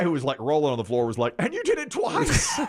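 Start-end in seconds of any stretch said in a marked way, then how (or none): 1.4-2.6 very high, squealing through laughter; laughing disbelief. Retold or imitated. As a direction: imitate a friend helpless with laughter. Laughing friend rolling on floor, retold.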